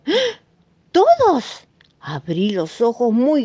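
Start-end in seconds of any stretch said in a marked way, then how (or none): 0.1-1.7 much higher, squeaky disbelief; shocked. Acted. Marina hearing a cat took every pacifier, shocked. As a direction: gasp out the question in total shock.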